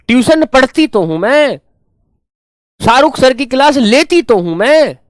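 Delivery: put-on high, loud child's protest, each line ending on a punched मैं; a daughter protesting "तो हूं मैं" loudly in a high voice; emphatic insisting. Shown as a demonstration. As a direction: insist hard, line after line, that you do it all. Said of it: "क्या कहते हैं उसको? Emphatic".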